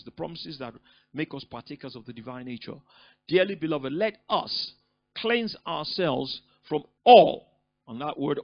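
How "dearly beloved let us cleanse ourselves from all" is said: loud, slow, drawn-out declaration; proclaiming scripture. Acted. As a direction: thunder it out slowly from the pulpit. Preacher proclaims scripture emphatically.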